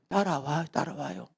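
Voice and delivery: quiet, unbothered follow me; calm pastor. Acted. Pastor calmly commands.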